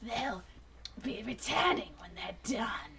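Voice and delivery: slow, whispered drawl; drawled Dinobot line. Acted. Dinobot drawls to the Maximals, slow and hushed. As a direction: let the words crawl out slowly and hushed. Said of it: "Dinobot drawled".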